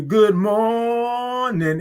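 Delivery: "morning" held very long, high; drawn-out good morning. Real, not acted. Host greeting all the viewers, "morning" drawn way out. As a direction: stretch "mor" into a long high note, then land "ning". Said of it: "all those O's and R's and I N G's".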